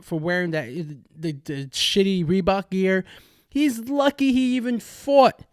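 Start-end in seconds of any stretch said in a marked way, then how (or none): 3.5-5.4 exasperated shout. Real, not acted. Ranting about the champ's weight miss.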